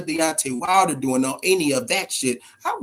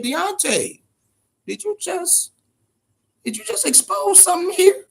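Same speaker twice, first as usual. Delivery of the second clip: much higher, disbelieving call to Deontay; incredulous question; 11 semitones higher than usual. Retold or imitated. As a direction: ask him in mock disbelief. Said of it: "I said".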